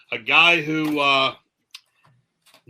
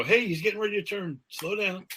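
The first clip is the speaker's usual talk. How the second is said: higher, softer mild voice; considerate California driver; 5 semitones higher, 8 dB quieter than usual. Retold or imitated. California driver seeing a turn signal, mildly considerate. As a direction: lightly voice an obliging driver, quick at first. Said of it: "when he was in California".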